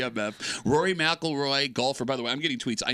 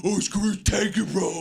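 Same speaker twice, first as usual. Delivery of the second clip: mocking imitation; 2 semitones higher than usual. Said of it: "You go".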